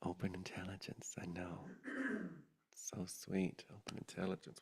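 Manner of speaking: hushed, devotional whisper. Real, not acted. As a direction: breathe it out reverently. Soft devotional closing words.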